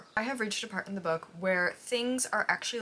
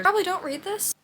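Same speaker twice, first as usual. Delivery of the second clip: higher, louder, teasing warning; 11 semitones higher, 7 dB louder than usual. Real, not acted. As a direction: warn them playfully. Warning viewers.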